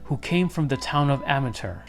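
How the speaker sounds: ordinary delivery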